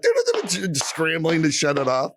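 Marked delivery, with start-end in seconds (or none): 0.0-0.7 high squeaky flustered imitation; flustered wife mock. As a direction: mimic the panicked wife fumbling. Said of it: "And she's like".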